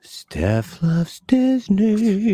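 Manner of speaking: slow, stretched announcer call; drawn-out announcer. Pretend. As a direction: stretch every word like an emcee. Drawn-out echo of a name.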